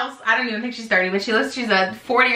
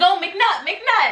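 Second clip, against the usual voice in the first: high-pitched mock delighted recognition; as if the name just clicked, pitched up; retold recognition; 9 semitones higher, much slower than usual. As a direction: imitate someone suddenly remembering.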